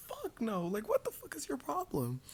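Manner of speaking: outraged disbelief. Retold or imitated. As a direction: let the outrage spill over. Outraged at boyfriend.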